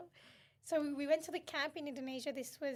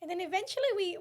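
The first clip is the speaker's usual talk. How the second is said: laughing; 6 semitones higher, 9 dB louder than usual. Real, not acted. Laughing while telling.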